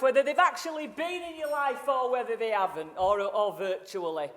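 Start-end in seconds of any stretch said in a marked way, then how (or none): none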